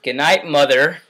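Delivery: loud call to mother. Real, not acted. Vlogger interrupted while filming, calling loudly to mother. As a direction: raise your voice to say goodnight.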